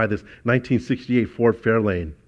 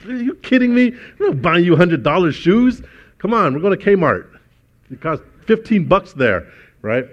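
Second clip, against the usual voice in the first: high, loud mock mother scolding; outraged mom; 8 semitones higher, 6 dB louder than usual. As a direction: ham up the indignant mom turning down the shoes. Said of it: "She's like".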